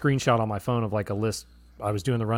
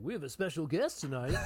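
laughing copy of the host's intro line; soft, laughing take on the host's intro; mock announcer; 3 semitones higher, 10 dB quieter than usual. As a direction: tease him by parroting his intro. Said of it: "You yourself many times been like".

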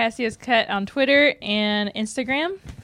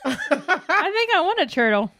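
laughing; 8 semitones higher than usual. Real, not acted. Laughing at turtle joke.

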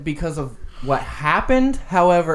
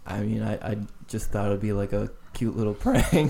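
much softer, fast, guilty little excuse; sheepish mumble; 7 semitones lower, 7 dB quieter, faster than usual. Retold or imitated. Prankster owning up to the angry friend, sheepish soft mumble. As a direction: mumble it like a caught kid. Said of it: "and I was like".

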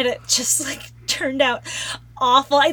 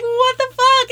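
shocked; 11 semitones higher, 4 dB louder, slower than usual. Retold or imitated. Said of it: "He was just like".

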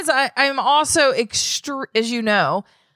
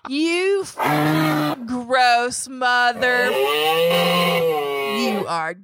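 slow, disgusted mock tirade; slow hammed-up self-disgust tirade ending in a fake sob; imagined harsh insults; much slower than usual. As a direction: drag each insult out like a harsh inner critic. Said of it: "here's what I heard".

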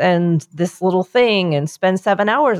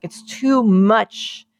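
slow overwhelmed; much slower than usual. Retold or imitated. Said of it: "I was like".

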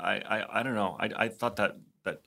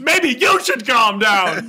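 high, loud, drawn-out mock retort; hammed-up shrieked indignation, stretched slowly; heated comeback; 12 semitones higher, 10 dB louder, much slower than usual. Acts out Ted's heated comeback.

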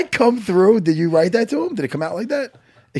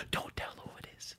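hushed unvoiced whisper; retold secret whisper; 26 dB quieter than usual. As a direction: whisper it so nobody hears. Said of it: "he was whispering to me".

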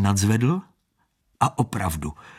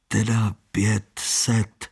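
slow, hesitant mumble; reluctant; slower than usual. Acted. Standa forcing out his bet, slow and reluctant. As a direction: drag the words out unwillingly. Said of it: "vysoukal ze sebe Standa".

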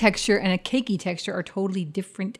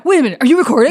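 imitating him; 2 semitones higher, 10 dB louder, much faster than usual. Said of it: "'cause he says every time".

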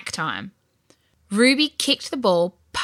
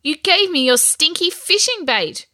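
higher, louder scolding; angry accusation; 8 semitones higher, 5 dB louder than usual. A narrator's reading. Ruby blames her brother for the bait, angrily accusing. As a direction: accuse him indignantly.

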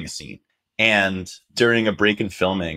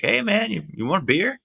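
much higher, goofy street guy voice; imitating Radio Man; 12 semitones higher than usual. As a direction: toss off a friendly offer in a high put-on voice. Radio Man offering beer, voiced.

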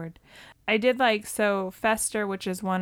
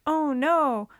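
mock panic; 5 semitones higher, 4 dB louder than usual. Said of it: "says, like".

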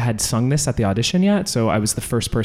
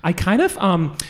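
usual speaking voice

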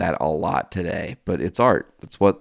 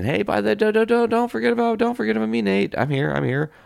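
much higher squeaky cartoon train calling out; a high playful voice for the train begging not to be forgotten; voicing the train; faster than usual. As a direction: play the train as a needy little character. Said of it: "Train of our existence showing up saying".

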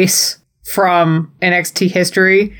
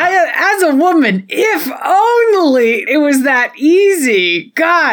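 raised, strained voice full of rueful exasperation; exasperated cry; 9 semitones higher than usual. Real, not acted. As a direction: cry it out like a wish that can never come true. Woman reacts to wrestling abuse.

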